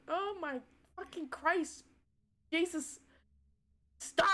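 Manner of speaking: high, loud anguished outburst; overwhelmed outcry. Real, not acted. Player crying out at the sad scene. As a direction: shout at the game to stop.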